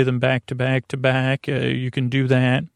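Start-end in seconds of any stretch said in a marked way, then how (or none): none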